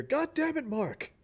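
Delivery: high, far-off exasperated yell from a captain; shouting captain. Acted. Captain yelling at Mark across the cave. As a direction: bellow at the crew in frustration.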